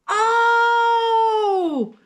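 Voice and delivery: high loud delighted cries; thrilled the pots came out as wanted; overjoyed. Real, not acted. Opening kiln, results are perfect.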